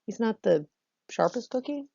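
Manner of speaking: ordinary talk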